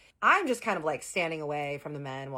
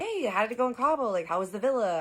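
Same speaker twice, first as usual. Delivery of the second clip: eager question; 8 semitones higher, 8 dB louder than usual. Retold or imitated. Bob eagerly asks about trip.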